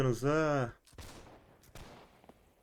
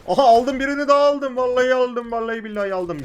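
high, loud triumphant crowing; gleeful bragging yell; elated shout; 8 semitones higher, 8 dB louder than usual. Real, not acted. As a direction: celebrate each kill. Scoring kills, elated.

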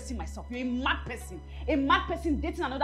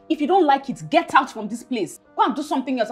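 loud, irritated brush-off; angry dismissal; 3 semitones higher, 6 dB louder than usual. Acted. Tenant telling landlord to leave her eating alone, angry. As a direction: send them away, raising your voice.

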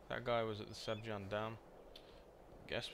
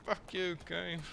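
loud, high yell at the game; angry curse; 8 semitones higher, 4 dB louder, much slower than usual. Real, not acted. Player cursing the game that robbed his kill, furious yell. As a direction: spit it at the screen, furious.

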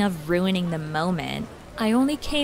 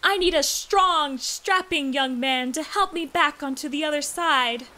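high, hammy damsel plea; fluttering call for a strong man; damsel in distress; 6 semitones higher than usual. Pretend. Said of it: "pretending to be a damsel in distress".

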